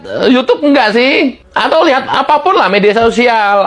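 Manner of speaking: ordinary talk, nothing marked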